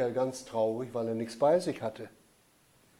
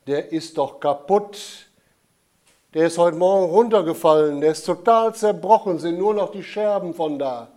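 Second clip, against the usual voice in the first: put-on loud, dismayed child protesting; upset boy; 2 semitones higher, 7 dB louder than usual. A narrator's reading. Michael telling the Jesus child the mug is broken, loud and upset. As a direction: blurt it out, distressed.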